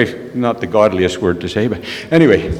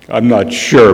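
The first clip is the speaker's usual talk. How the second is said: ordinary talk, nothing marked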